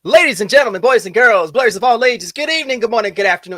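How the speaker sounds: booming announcer voice, high and loud; grand entrance announcement. Acted. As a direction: welcome the crowd like a ringmaster. Stream opening to viewers.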